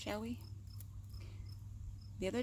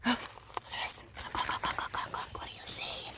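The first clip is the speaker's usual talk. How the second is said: hushed excited whisper. Real, not acted. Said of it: "Shh, don't wake them up".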